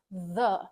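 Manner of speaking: "the" with a clearly sounded TH; teacher modelling the TH in "the"; TH demonstration. Shown as a demonstration. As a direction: sound out "the" by itself as a TH example. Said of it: "learn how to pronounce the T H".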